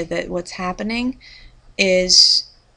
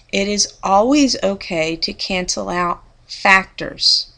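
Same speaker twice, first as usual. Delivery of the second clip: factors set apart and punched louder; stress on factors; 3 dB louder than usual. Real, not acted. Teacher telling students what can be canceled, factors emphasized. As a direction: land firmly on the word factors after a beat. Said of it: "Notice I emphasize factors".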